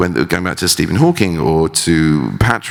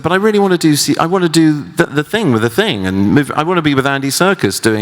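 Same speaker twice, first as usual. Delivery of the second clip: eager pleading; 11 semitones higher than usual. Retold or imitated. Actor begging director to do the motion capture, high eager plea. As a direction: plead like a kid desperate to join in.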